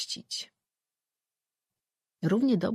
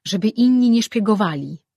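louder, stressing inni; loud scornful ending; 5 semitones higher, 10 dB louder than usual. Acted. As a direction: hammer the point home with scorn. End of Juan's angry speech.

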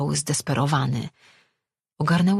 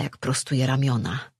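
ordinary delivery

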